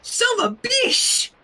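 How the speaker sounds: put-on high, slow baby voice shrieking a curse; baby cursing. Toddler Flurry swearing back at Sunset, squeaky and high. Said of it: "Flurry agreed".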